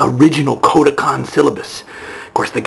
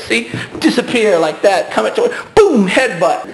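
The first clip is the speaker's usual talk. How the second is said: ordinary talk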